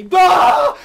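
high, loud startled yelling; frightened yell. Retold or imitated. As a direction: yell back in shock. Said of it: "My scream go through the air".

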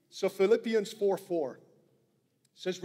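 ordinary talk, nothing marked

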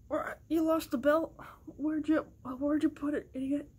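scolding character voice. Pretend. Toy figure scolding over lost belt.

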